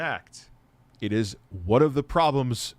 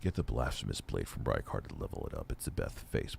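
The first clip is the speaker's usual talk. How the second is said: stoner voice; 12 dB quieter than usual. Real, not acted. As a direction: sound baked and sleepy, words sliding low. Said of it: "you know I'm stoned right now? 'Cause listen to my voice".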